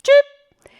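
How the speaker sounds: very high, loud electronic chirp; imitated alarm cheep. Shown as a demonstration. Storyteller imitating the gas detector, piercing cheep. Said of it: "more like a cheeping".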